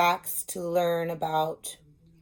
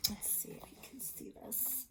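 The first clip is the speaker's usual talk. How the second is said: a soft unvoiced whisper to the class; whispered aside; 22 dB quieter than usual. Real, not acted. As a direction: murmur it to yourself while holding up the book. Teacher's aside while showing book.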